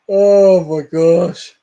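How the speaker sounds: slow drawn-out oh my gosh; laughing. Real, not acted. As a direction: laugh it out, exasperated. Laughing at absurdity.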